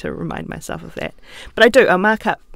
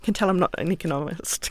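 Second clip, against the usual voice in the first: ordinary talk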